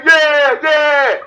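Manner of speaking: loud, high drawn-out cheer; mock cheering. Imitating people who hold him up, mock cheering. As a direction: shout it high like an adoring crowd, in mockery. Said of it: "hold you up and say".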